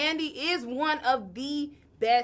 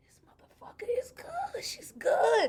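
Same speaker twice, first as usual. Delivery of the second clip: awed whisper; 12 semitones higher, 3 dB quieter than usual. Retold or imitated. Host quoting her own awe.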